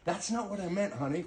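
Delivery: mock husband. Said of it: "Ask my wife".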